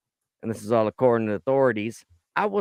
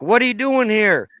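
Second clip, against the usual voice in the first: startled yell; 8 semitones higher, 6 dB louder than usual. Retold or imitated. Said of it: "The chick was like".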